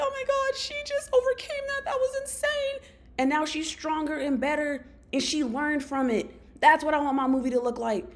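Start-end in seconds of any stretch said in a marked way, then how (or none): 0.0-2.9 much higher, tearful awestruck gasp; awed crying audience. Retold or imitated. Audience in her imagined movie, moved to tears, squealing high. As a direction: play the teary crowd amazed at her comeback. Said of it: "the audience is, like, crying 'cause they're like".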